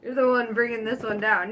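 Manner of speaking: normal talking voice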